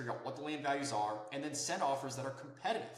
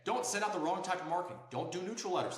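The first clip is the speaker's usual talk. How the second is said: usual speaking voice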